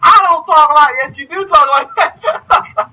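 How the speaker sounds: high Southern back-and-forth, hammed up; exaggerated, high-pitched Southern protest; mock Southern accent. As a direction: act out a squabble in Southern accent. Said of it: "I used to always use that accent".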